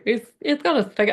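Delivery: put-on a light, lilting Irish accent; playful light Irish accent. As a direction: slip into a hint of Irish brogue. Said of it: "I don't know why I went Irish".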